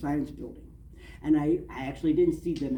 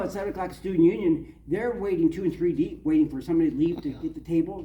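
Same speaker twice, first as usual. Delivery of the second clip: usual speaking voice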